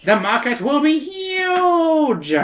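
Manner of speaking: very high, slow mad-scientist voice; a giddy, squeaky inventor crowing about profits; eccentric professor. Acted.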